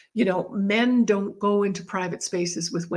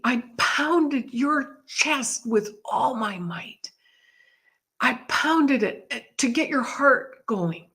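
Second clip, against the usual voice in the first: slow, weighty words, partly whispered; intense retelling; 3 semitones higher, slower than usual. Retold or imitated. Friend telling her how he fought to revive her, slow whispery intensity. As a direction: drag out each word with whispery force.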